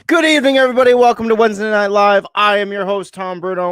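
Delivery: big, high announcer greeting; show host opening. Acted. Host greets the audience to open the live show, big announcer greeting.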